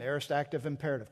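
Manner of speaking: usual speaking voice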